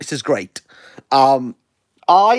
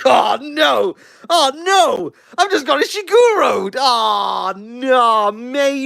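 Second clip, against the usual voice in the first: mock despair; 6 semitones higher, much slower than usual. Real, not acted. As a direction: wail it in fake despair. Mock despair over Ishiguro.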